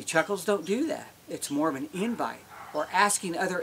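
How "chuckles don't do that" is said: ordinary delivery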